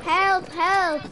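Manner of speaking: loud cry for help; calling for help. Real, not acted. Gamer shouting to teammate for help.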